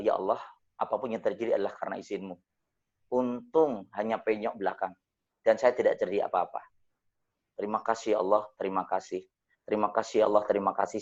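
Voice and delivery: soft, low murmured thanks to God; hushed grateful prayer. Retold or imitated. Driver after a crash thanking God, soft and low. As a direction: breathe out thanks quietly, relieved.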